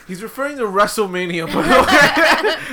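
can barely get it out for laughing. Real, not acted. Explaining the pun to listeners, cracking up, laughing.

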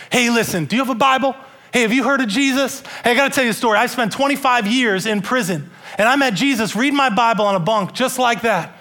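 Rodney preaching to a man in his cell, fervent. Retold or imitated. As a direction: preach hope straight at the inmate. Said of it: "Rodney's just preaching".

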